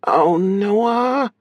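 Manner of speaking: high, drawn-out tearful sister; high-pitched, weepy reply. A narrator's reading. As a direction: voice her as if through muffled sobs. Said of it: "Muffled sobbing greeted his announcement".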